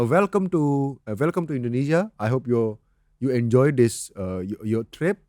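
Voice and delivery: light Indian accent, unhurried; understated Indian-accented English; subtle Indian accent. Shown as a demonstration. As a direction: keep the Indian accent thin and careful. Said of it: "yang tipis aja D-nya".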